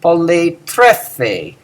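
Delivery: poletrefe very slow, poly unaccented; very slow poletrefe with the stress near the end; slow model word. Shown as a demonstration. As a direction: drawl poletrefe syllable by syllable. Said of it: "lots of eating".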